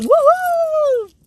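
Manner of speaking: high, loud woohoo; real joyful whoop; excited whoop. Real, not acted. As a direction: cheer for the new phone. Celebrating new phone.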